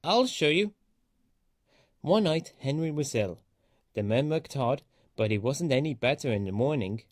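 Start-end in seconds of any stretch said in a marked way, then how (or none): 0.0-0.7 higher, defiant little boast. A narrator's reading. Thomas boasts to the laughing engines, defiant and high. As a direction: prove them wrong, chin up. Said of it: "said Thomas".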